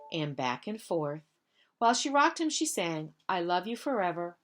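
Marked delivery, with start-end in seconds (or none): none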